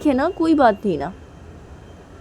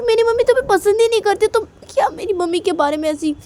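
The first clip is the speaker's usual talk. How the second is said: high, loud upset questioning of mother; a young wife voiced high and loud, fretting over her mother-in-law; upset girl's lament; 11 semitones higher, 5 dB louder than usual. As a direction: plead and complain in a raised girl's voice. Character's high distressed lament.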